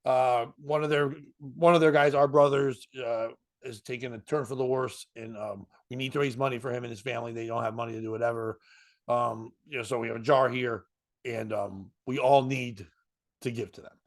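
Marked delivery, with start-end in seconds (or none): none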